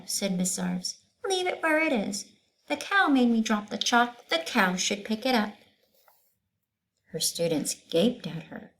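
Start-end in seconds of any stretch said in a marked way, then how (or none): 1.3-5.5 high, lilting schoolteacher; teacher voice. Acted. Teacher explaining shapes to her class, high teacher voice. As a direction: voice Miss Zarves teaching the class.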